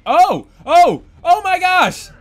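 high, loud rising oh; surprised gasps. Real, not acted. Gamer gasping as the climb works. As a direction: gasp in delighted shock. Excited surprise during play.

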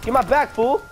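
normal talking voice

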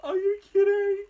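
high, loud cry of disbelief; real fangirl scream of shock; stunned shriek. Real, not acted. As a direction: shriek it out, overwhelmed with excitement. Stunned reaction to a new song.

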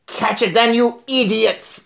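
high, commanding witch screech; screaming Grand High Witch. A narrator's reading. Witch leader ordering her followers from the platform, screaming. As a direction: scold them in a high witch voice, drawing out the end. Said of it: "screamed the Grand High Witch".

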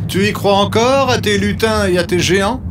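high, jeering tease; mocking villager. A narrator's reading. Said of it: "disent certains villageois".

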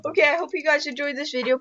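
fast throwaway outro line; rushed outro. Real, not acted. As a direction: rattle off the sign-off. Rushed outro mid-game.

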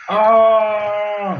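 high loud scream. A narrator's reading. Grandma screaming loudly, high scream. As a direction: let out a huge scream. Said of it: "гучнішого крику того дня ще ніхто не чув".

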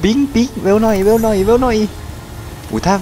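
high, loud panicked hurrying; frantic urging. Real, not acted. Gamer frantically hurries the teammate during the chase, high and loud.

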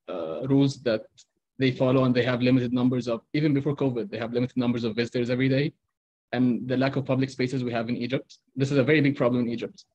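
ordinary delivery

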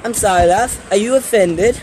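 put-on British game heroine; Tracer. Vlogger teasing offended viewers, British impersonation. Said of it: "That's my Overwatch impersonation of Tracer".

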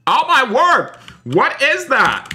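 sudden high shriek of shock; shocked cry. Real, not acted. Streamer spots a monster at the Twist, shocked high cry.

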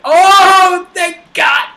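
high, loud burst of relief; relieved shout. Real, not acted. Relief after hiding.

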